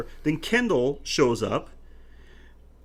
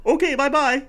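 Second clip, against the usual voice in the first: higher, louder imitated farewell; mock cheery goodbye; 8 semitones higher, 8 dB louder than usual. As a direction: act out a breezy, abrupt exit. Acts the man's abrupt cheery exit.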